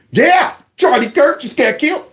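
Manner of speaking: mock gleeful coworker.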